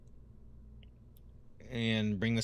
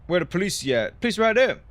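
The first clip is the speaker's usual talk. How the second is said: loud, high meme-video voice; shouting the Fourth of July vine; quoting a vine; 8 semitones higher, 11 dB louder than usual. Retold or imitated. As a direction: belt it like the viral clip. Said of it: "That's my favorite Fourth of July vine".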